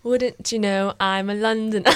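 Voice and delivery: slow, put-on London accent; hammed-up London accent, drawn out; mock London accent. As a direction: play up being a Londoner. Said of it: "British accents".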